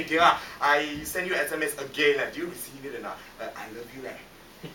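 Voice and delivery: soft, low pleading on the phone; small plaintive voice. Retold or imitated. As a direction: shrink into a meek, hopeful murmur. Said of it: "I get so frustrated, I'll call her".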